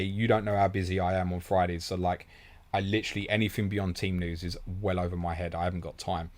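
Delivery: ordinary talk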